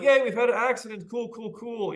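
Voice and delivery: put-on high, delighted childish cheer; gleeful kids. Children cheering that the family crashed, high and gleeful. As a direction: squeal it like thrilled children.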